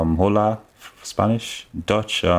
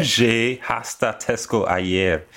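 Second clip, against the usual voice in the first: slow careful Spanish; much slower than usual. Acted. Reads Spanish translation aloud.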